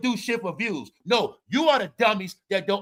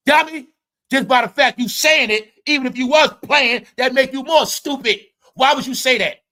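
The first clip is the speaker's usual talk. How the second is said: loud, higher-pitched rant; exasperated scolding; 4 semitones higher, 8 dB louder than usual. Acted. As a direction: berate him at full volume for his stupidity. Scolding the player as if present.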